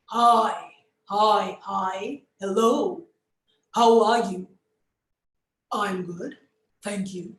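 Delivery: put-on ground it low like a wise old man; bass voice. Shown as a demonstration. Teacher demonstrating an older character's bass voice. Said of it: "a bass voice starts from your diaphragm".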